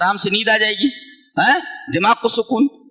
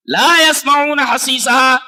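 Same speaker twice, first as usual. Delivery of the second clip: slow, drawn-out chanted verse; slow melodic recitation; Quran recitation; 5 semitones higher, 5 dB louder, much slower than usual. Acted. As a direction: stretch each syllable like a reciter.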